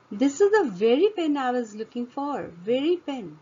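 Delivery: very hit high and falling; very pen, very stressed, then repeated; teacher punching very for the class. Shown as a demonstration. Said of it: "Emphasizing it".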